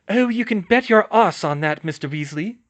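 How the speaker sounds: high and loud, angry; furious school nurse scolding; angry Madame Pomfrey. Acted. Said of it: "said an angry Madame Pomfrey".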